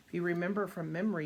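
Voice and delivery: ordinary delivery, nothing marked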